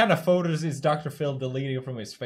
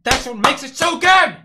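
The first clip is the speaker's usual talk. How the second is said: high, loud delighted yell; loud, high, overexcited exclamation; thrilled outburst; 8 semitones higher, 10 dB louder than usual. Real, not acted. Thrilled reaction to clip.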